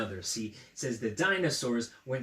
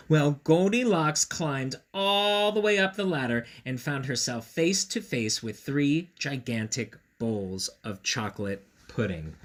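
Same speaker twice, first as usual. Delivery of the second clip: usual speaking voice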